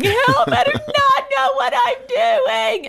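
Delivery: high, slow tearful plea; crying plea. Retold or imitated. Overwhelmed mother pleading with God, high slow crying. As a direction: beg for help, voice high and stretched. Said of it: "And I'm crying".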